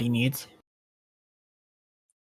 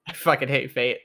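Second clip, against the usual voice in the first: usual speaking voice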